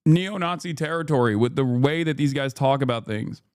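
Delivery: normal talking voice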